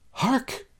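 put-on high theatrical "Hark!" in a girlish voice; dramatic cry. Young bride calling out on the garden path, high and theatrical. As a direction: sing it out like a melodramatic maiden. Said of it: "cried Fainting Maid".

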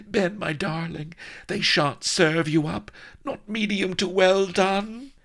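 very high, anguished woman's voice; distressed lover. A narrator's reading. Vanity swears Ben shan't be served up, high distress. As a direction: protest in horror for your beloved.

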